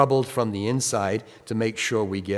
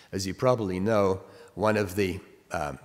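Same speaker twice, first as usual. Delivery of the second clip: ordinary talk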